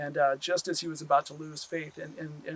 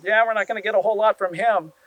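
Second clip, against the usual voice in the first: louder wry aside; 7 semitones higher, 11 dB louder than usual. Acted. Turtle gives up on the stranger.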